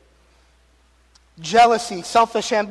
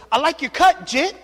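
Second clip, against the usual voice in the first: sassy daughter; 7 semitones higher, 6 dB louder than usual. Retold or imitated. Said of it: "and she goes".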